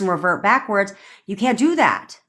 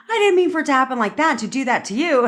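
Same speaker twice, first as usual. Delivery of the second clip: high, quick imitation of a friend protesting; retold protest; 8 semitones higher, faster than usual. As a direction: protest in a mock high voice, hurried.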